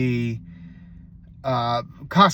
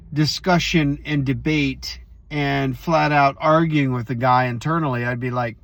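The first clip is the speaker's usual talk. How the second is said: ordinary talk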